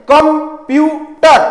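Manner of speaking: Computer drawn out slowly, syllable by syllable; slow syllable demo. Shown as a demonstration. Teacher showing chin drops, slow syllables. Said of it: "every time your Chin drop".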